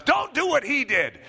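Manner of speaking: imitated coach addressing the squad; coach warning class. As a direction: warn the team off his example. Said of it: "speaking to the rest of the class".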